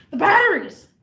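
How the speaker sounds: nipping. Retold or imitated. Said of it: "he's already nipping at me".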